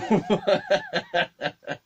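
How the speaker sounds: high, rapid burst of laughter; real giggling fit; laughing. Real, not acted. Teller recalling the funny old man, laughing. Laughing at his own memory.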